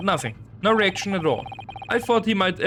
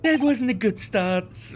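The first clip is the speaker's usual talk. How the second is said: ordinary talk, nothing marked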